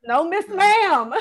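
slow laughing repeat. Retold or imitated. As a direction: drag it out while laughing.

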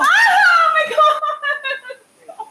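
high, loud, slow laughing cry; pained scream. Real, not acted. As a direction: shriek through pain and laughter. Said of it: "I know I screamed".

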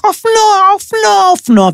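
very high, insistent demanding cry; mock teenage screaming. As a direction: imitate a teenager screeching for a toy. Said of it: "ואתה רואה אותו צורח". (חיקוי צרחני של נער דורש)

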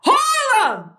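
yell. Retold or imitated. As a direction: call out the neighbourhood name. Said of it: "I yell".

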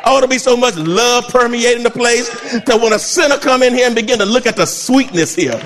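ordinary talk, nothing marked

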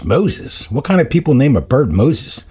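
mock disbelief for a punchline; incredulous scoff. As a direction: play a burglar baffled by the name. Burglar scoffs at parrot's name.

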